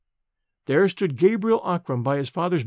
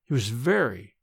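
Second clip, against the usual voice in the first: ordinary delivery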